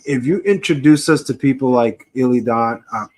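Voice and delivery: normal talking voice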